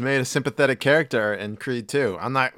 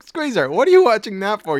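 mock scolding; 9 semitones higher, 6 dB louder than usual. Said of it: "I'm like".

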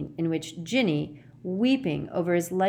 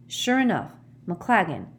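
plain reading voice

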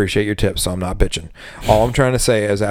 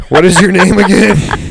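higher, louder, teasing coworker; a coworker's cheeky jab, pitched up; mock coworker; 8 semitones higher, 7 dB louder than usual. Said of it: "the dishwasher ... was just like".

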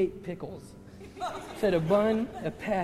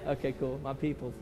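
ordinary delivery

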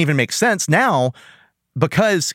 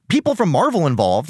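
normal talking voice

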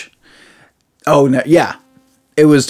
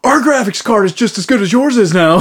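smug console voice; 9 semitones higher than usual. Retold or imitated. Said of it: "was like".

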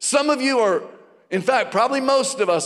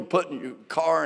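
usual speaking voice

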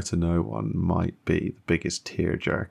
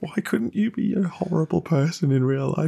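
much higher, playful protest; mock complaint; 9 semitones higher than usual. Real, not acted. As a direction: complain about his kindness. Gushing over Sean Astin.

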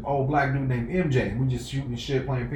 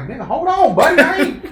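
overwhelmed protest; 11 dB louder than usual. Retold or imitated. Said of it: "I'm like".

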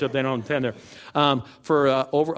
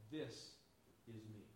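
hushed Jesus; 4 semitones lower, 29 dB quieter than usual. Retold or imitated. Jesus answering the speaker in the vision, very soft and hushed. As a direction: let each word fall softly into silence.

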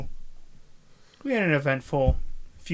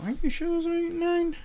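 squeaky doubtful voice; mocking high voice; faster than usual. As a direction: tease in a high silly voice. Mimics co-host doubting, high voice.

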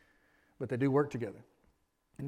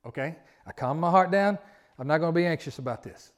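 mock self-talk; 2 semitones higher, 9 dB louder than usual. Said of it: "the more you talk like that".

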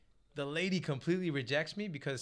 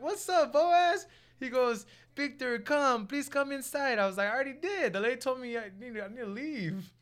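cheery greeting; 7 semitones higher than usual. Retold or imitated. Greets casting director, higher voice.

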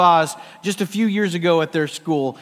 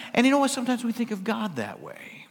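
ordinary talk

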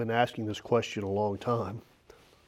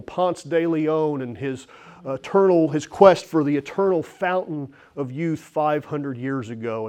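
usual speaking voice

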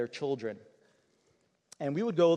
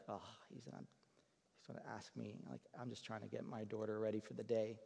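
much softer and lower, a mild defensive grumble; defensive mother, hushed; 4 semitones lower, 15 dB quieter than usual. Retold or imitated. Homeless mother annoyed at the young pastor, hushed grumble. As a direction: mutter her annoyance half to herself.

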